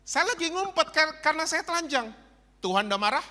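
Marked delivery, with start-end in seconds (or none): 0.0-2.1 Adam hiding. Acted. Preacher voices Adam answering God, high and whiny. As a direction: sound like a caught child. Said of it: "Adam jawab".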